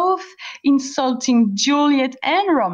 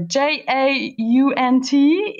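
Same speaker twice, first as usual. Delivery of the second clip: letters spelled out slowly; slow spelling; much slower than usual. Shown as a demonstration. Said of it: "spelling the word out".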